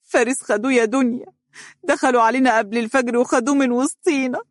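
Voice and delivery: grieving mother, lightly tearful. A narrator's reading. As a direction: tell the news through tears. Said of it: "وهي تبكي وقالت".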